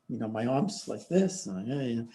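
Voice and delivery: normal talking voice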